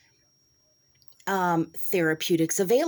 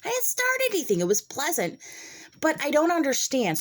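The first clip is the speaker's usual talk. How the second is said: indignant protest; 9 semitones higher than usual. Real, not acted. Defending herself, indignant.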